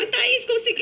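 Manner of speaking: high-pitched imitation of a thrilled director; high, acted burst of triumph; excited cheer. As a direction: mimic someone jumping up at a success. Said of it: "ele dá um salto lá".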